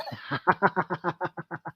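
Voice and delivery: soft, rolling chuckle; amused laugh. Real, not acted. Speaker amused by mother's sudden decision, chuckling laugh. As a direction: let out a long, soft chuckle.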